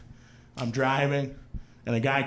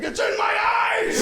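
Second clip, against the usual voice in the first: very high strained mock scream; panicked shriek. Blindfolded friend reacts to the prank, panicked shriek.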